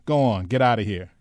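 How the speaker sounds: soldier's impatient command; dismissive order. A narrator's reading. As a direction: wave them away with your voice. Soldier waves them through.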